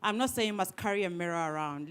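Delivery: ordinary talk, nothing marked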